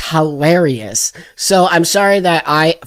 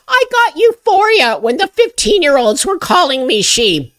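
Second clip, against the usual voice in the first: high-pitched mock teacher impression; mocking quote; 10 semitones higher than usual. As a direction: mimic them in a high, gushing put-on voice. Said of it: "She just said".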